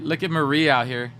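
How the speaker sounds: usual speaking voice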